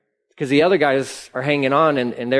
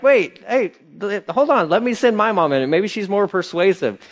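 high-pitched, hurried interjection; flustered scheming; 6 semitones higher than usual. Acted. Preacher voices disciples wanting to send mom in, flustered and high. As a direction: cut in as if angling for a turn. Acts out disciples scrambling for advantage.

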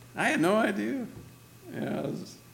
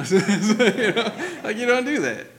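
amused, laughing through the words; real laughing; 9 semitones higher, 9 dB louder, slower than usual. Real, not acted. Preacher to congregation on ruining a wedding, laughing. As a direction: talk through laughter, higher and louder. Cracking up at own wedding example.

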